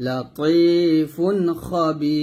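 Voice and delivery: Hakim drawn out very slowly at the stop; slow tajweed recitation; Quran recitation. Shown as a demonstration. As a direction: chant আলিমুন Hakim, holding the end. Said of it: "যদি ওয়াকফ ধরে পড়েন তাহলে বলতে হবে".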